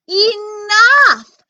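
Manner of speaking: high, loud outburst; angry shout. Acted. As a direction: snap at them all. Narrator loses patience.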